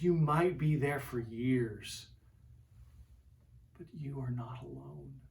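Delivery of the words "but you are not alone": sudden hushed whisper. Acted. Preacher assuring the suffering listener, whispered. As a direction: drop to a whisper of comfort. Comforting the hurting congregation.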